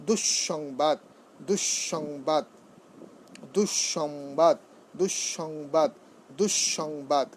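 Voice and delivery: "দুঃসংবাদ" as dush-shongbad, joined up; doubled s. Shown as a demonstration. Teacher drilling the doubled sibilant, "দুঃসংবাদ" as dush-shongbad. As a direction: lean on the double sh in each "দুঃসংবাদ". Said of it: "দন্তস্ব দুবার উচ্চারণ হয়েছে".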